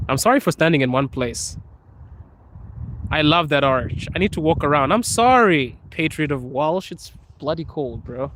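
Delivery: ordinary delivery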